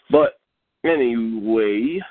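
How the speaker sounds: long drawn-out whine, rising at the end; slow, whiny drawl. Real, not acted. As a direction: stretch it out slowly, whining upward. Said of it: "you sound kinda whiny".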